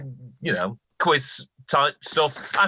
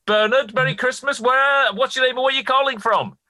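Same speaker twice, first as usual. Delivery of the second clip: overbright host greeting; 12 semitones higher, 4 dB louder than usual. Acted.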